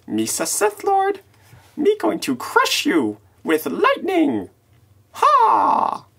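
very high, slow, squeaky Sith Jar Jar voice; cartoonish high-pitched villain boast ending in a cackle. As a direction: play a silly alien gloating about his new dark powers. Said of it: "Darth Jar Jar".

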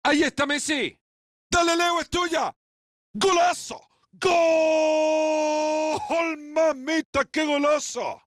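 high, loud shouted goal call; breakneck goal commentary. Acted. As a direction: scream the goal with full joy.

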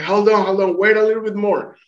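high, drawn-up calling voice; imitated urgent call. Friend urging the swimmer to hang on, calling out. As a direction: call out as if across water, pitched well up. Friend calls to drowning man.

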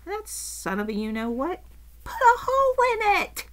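high, pained indignation; aggrieved, rising near-wail; upset complaint. Real, not acted. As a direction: let the pitch climb sharply in dismay. Upset about her torn jacket.

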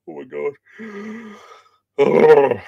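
high, drawn-out words into a big yawn. Real, not acted. Tired reader interrupted mid-reading, real yawning. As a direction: overtaken by a loud yawn. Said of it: "Apologies for the yawning".

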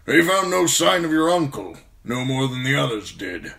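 put-on loud, high old commander's voice; old bear commander. Commander tells young man about his missing uncle, loud old voice. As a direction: report bad news as a forceful old officer.

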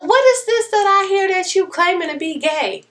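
high, stern grandma voice; grandma questioning. Retold or imitated. Grandma confronting granddaughter on the phone, high stern voice. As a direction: press her like a churchgoing grandma. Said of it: "She was like".

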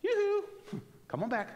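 sing-song call with a high yoo-hoo; God calling out to the wandering speaker, sing-song yoo-hoo; playful call. Retold or imitated. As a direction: call him back like a friendly neighbour. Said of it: "God's gotta grab me and go".

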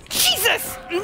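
high scream of fright; startled shriek. Real, not acted. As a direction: shriek as the monster appears. Jump scare in horror game.

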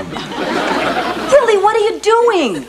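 high, drawn-out exasperation; dismayed. Acted. Wife to husband siding with Alf, dismayed. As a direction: scold your husband in disbelief, pitched high.

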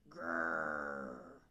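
low, loud rolling growl; deep rumbling bear noise. A narrator's reading. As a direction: growl long like a big bear.

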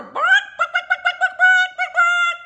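very high, loud rapid bawks; hen squawking. Acted. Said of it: "what the hen says".

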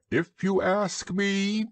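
high, slow owl voice. A narrator's reading. Owl offers his opinion, high and slow.

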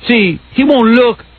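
high, slow mock Puerto Rican accent. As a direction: imitate a Puerto Rican guy arguing, drawn out. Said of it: "the Puerto Rican guy comes up and goes".

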